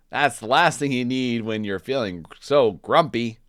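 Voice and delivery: Norman's voice. Acted. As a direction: sympathetic friend voice.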